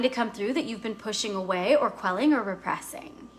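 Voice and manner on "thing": normal talking voice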